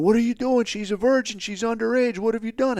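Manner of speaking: angry locals caught the Marine; outraged accusers. Retold or imitated. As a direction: burst in shrieking accusations. Acted mob bursting in accusing.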